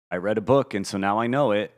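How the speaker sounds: mock naive voice. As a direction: ham up a self-satisfied know-it-all. Mocking naive self-belief, retold.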